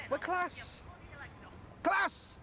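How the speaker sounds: a coach's rallying yell; rousing cry. Acted. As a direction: pump the team up and peak on "Class!". Manager rousing his team.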